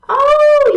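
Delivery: loud, high, sustained "ohh"; drawn-out "oh". Real, not acted. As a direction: let out a big high whoop of relief. Letting out of the held stretch.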